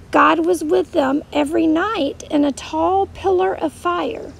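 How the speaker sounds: ordinary talk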